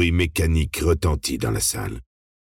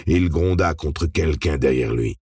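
usual speaking voice

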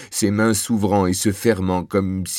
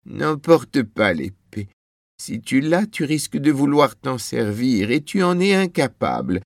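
higher-pitched pleading wife's voice; worried wife; 7 semitones higher than usual. A narrator's reading. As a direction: warn your husband off, pitch climbing. Wife pleads against taking the sword. (épouse inquiète, voix plus aiguë)

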